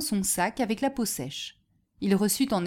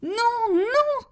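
very high, drawn-out cry; high, wailed refusal; terrified cry; 4 dB louder than usual. A narrator's reading. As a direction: beg in high-pitched panic. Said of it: "cria le chantre".

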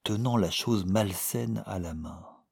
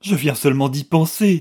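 high, loud, hysterical rush; 7 semitones higher, 10 dB louder than usual. A narrator's reading. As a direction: blurt it out in a frenzy. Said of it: "dit-elle hystérique". (hystérique, aiguë et forte)

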